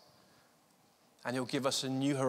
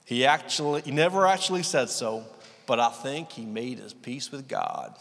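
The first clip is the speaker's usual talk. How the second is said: hammed-up attempt at a southern US accent; Southern American accent; 2 semitones lower than usual. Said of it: "says in his wonderful southern accent".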